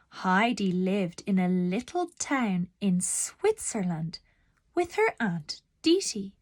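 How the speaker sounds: plain reading voice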